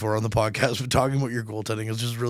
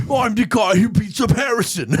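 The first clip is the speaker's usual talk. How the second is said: mock Statham; 7 semitones higher, 3 dB louder than usual.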